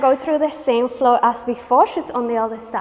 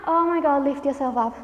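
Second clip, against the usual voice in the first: ordinary talk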